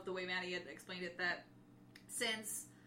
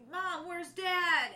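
high, slow, louder plea; mock anxious boy, higher and louder; worried David; 8 semitones higher, 8 dB louder, much slower than usual. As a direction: beg for an answer. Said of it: "David's like".

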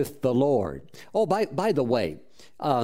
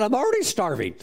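excited retort; 7 semitones higher, faster than usual. Retold or imitated. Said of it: "I thought".

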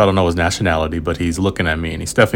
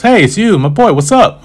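much higher and louder, bubbly hello; excited kid greeting; 13 semitones higher, 7 dB louder than usual. Retold or imitated. Boy spotting the traveler again, excited greeting. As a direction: squeal it like a thrilled kid. Boy recognizes narrator at gate.

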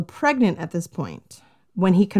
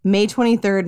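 ordinary talk

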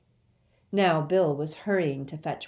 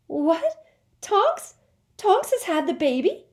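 much higher, loud astonished shriek; 14 semitones higher, 7 dB louder than usual. Acted. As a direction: shriek with disbelief. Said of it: "Hermione shrieked".

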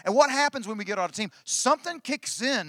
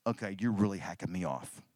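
low, quiet, contained irritation; imitated wife quietly fuming inside; wife's inner annoyance; 9 semitones lower, 7 dB quieter than usual. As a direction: say it under the breath, as a silent thought. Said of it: "Inside, she's going".

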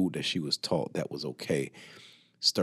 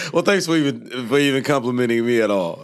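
higher and louder, still amused; laughing thanks; 7 semitones higher, 9 dB louder than usual. Real, not acted. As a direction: thank him while still chuckling. Laughing thanks after compliment.

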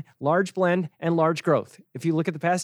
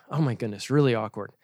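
ordinary delivery

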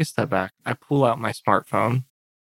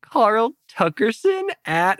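tag influencer; 6 semitones higher, slower than usual. Acted. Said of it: "I'm gonna say".

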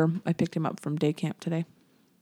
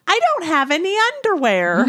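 put-on much higher kid voice complaining; whiny little girl; 10 semitones higher, 4 dB louder than usual. Mom imitating her daughter about underwear, higher whiny kid voice. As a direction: play the daughter whining. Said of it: "she goes".

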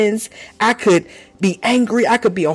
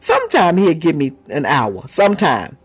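usual speaking voice

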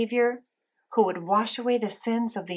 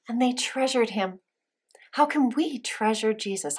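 usual speaking voice

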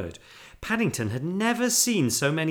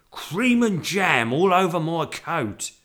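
loud, slow bitter complaint; 6 semitones higher, 6 dB louder, slower than usual. A narrator's reading. Taxi driver finding jam on coat, bitter. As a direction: grumble loudly, pitched up and drawn out. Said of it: "he said bitterly".

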